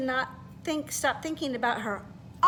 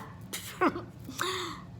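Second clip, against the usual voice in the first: acted giggle into a laugh; 4 semitones higher, 6 dB quieter than usual. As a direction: let the laugh out. Beatrice's laugh performed.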